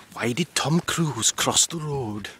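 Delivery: put-on amateur Welsh accent for the joke. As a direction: tell the punchline in a shaky attempt at Welsh. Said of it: "in a Welsh accent that I can't do".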